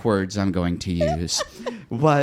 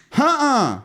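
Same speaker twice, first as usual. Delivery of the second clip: very high, louder mock-sassy exclamation; 5 dB louder than usual. Bartender reacts on learning it's her, high and sassy.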